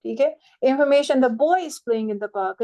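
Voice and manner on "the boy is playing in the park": put-on slow, with "boy" punched as the focus; stress on BOY. Shown as a demonstration. Teacher showing the listener it is a boy, stress on "boy". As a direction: hit "boy", ease through the rest. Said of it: "अगर मैं बॉय को stress कर रही हूँ".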